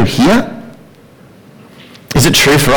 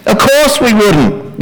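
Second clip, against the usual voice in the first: indignant exclamation. Acted. Indignant answer to rhetorical question.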